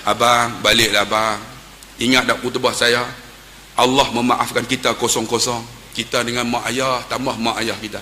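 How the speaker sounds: low, soft pleading counsel; gentle urging. Retold or imitated. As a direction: coax him softly to go home. Urging weeping man to go home.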